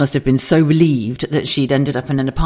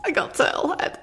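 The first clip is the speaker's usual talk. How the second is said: voiceless squeak through laughter; breathless laughter; 7 dB quieter than usual. Real, not acted. Teller repeating her flatmate's turtle head line, breathless with laughter. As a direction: wheeze the words out, high and soft. Repeats punchline while cracking up.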